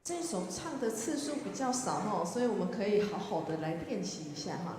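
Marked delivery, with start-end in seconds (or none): none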